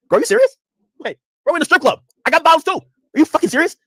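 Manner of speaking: high, incredulous sarcasm; sarcastic. Retold or imitated. To the offended regular in the strip club, high sarcastic disbelief. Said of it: "I'm a little bit sarcastic".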